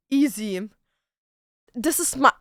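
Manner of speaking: normal talking voice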